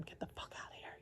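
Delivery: a yell done as an intense whisper. Retold or imitated. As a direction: shout it only in a whisper. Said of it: "You were, like, yelling at him".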